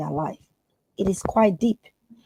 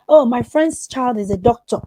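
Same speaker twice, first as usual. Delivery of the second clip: loud, higher, scolding parent; mock parent scolding a child; pushy parent; 3 semitones higher, 6 dB louder than usual. Pushy parent comparing children, retold.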